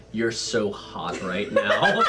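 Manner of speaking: low, slow come-on; lightly hammed-up low flirt; mock flirtation. Quoting an in-character flirtation.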